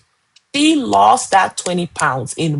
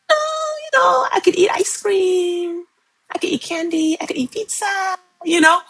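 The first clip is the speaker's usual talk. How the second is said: dreamy delight; 11 semitones higher than usual. Retold or imitated. Coach remembering carefree eating, high dreamy delight. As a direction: savor each treat like a happy memory.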